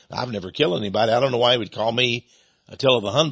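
ordinary talk

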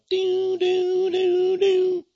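high siren wail imitated with the voice; police siren. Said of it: "and his light was going".

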